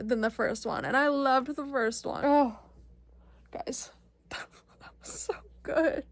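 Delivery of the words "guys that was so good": awed whisper. Real, not acted. Overwhelmed after the movie.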